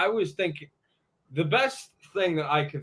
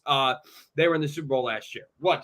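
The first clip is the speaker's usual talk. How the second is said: normal talking voice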